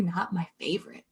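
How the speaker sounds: hushed real whisper, a bit quick. Real, not acted. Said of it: "I have to whisper 'cause she's outside the room".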